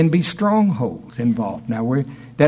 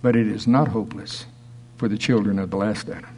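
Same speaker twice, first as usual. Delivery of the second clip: soft and tender; 5 semitones lower, 5 dB quieter than usual. Acted. Tender contrast after hopeless.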